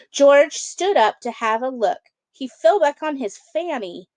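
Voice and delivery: lively storybook read-aloud; children's book narration. A narrator's reading. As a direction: read it like bedtime story time. Reads picture book to kids.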